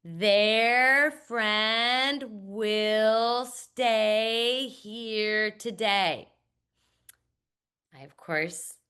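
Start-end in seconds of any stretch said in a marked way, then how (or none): 0.0-6.3 very slow, careful word-by-word reading; slow accurate read-aloud. Shown as a demonstration. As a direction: read each word out deliberately. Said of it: "all students ... say".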